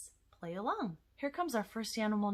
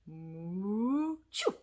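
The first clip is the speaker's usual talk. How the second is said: mooing sneeze. Acted. Cow puppet sneezes.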